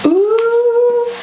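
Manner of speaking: a high, loud, thrilled ooh; excited ooh. Real, not acted. She opens the package from Sandy, a high, loud thrilled ooh. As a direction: squeal with delight at the box.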